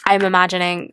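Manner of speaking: ordinary talk, nothing marked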